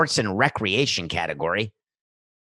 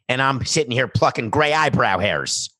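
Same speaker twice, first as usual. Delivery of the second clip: normal talking voice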